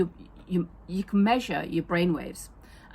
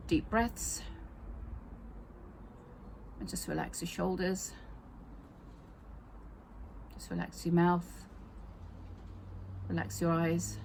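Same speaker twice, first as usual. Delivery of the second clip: softer, unhurried guided meditation voice; soothing massage murmur; 6 dB quieter than usual. Acted. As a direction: ease the listener into calm with long pauses. Leads a guided relaxation exercise.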